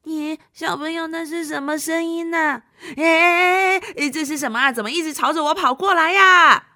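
startled as something charges; alarmed. Acted. Alarmed at approaching noise.

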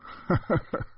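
high, unvoiced-sounding short ha-ha laugh; hearty chuckle. Policeman leaving after a joke, chuckling. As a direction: chuckle as a joking goodbye. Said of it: "片儿警哈哈笑着走了".

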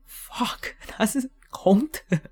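slow stunned whisper; whispered dismay at the empty roll; shocked whisper. Retold or imitated. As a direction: breathe it out in disbelief. Finding no toilet paper.

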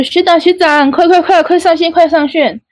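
excited urging. Retold or imitated. Teammates hurrying her online in eager high voices.

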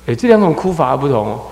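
ordinary talk

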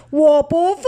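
loud roar of refusal; father roars at the cashier like a lion. Acted. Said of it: "爸爸像狮子大吼".

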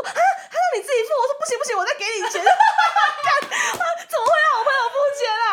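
high, loud, laughing disbelief; shocked laughing outcry. Real, not acted. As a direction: exclaim in disbelief. 听到朋友自己付钱后惊呼. (又笑又惊地大叫)

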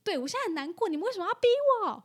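imitating an outraged owner shouting; mock furious outburst. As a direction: whip up into an accusing rage. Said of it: "然后就暴怒".

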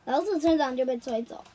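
usual speaking voice

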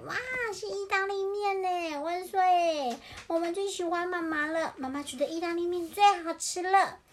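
put-on high, rising-energy child cheer; delighted chicks cheering. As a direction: exclaim it like thrilled little ones.

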